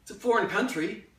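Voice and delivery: loud, climbing stage monologue; worked-up memoir narration; urgent storytelling. Acted. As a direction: tell it to a crowd, building in pitch. Performs a written memoir monologue.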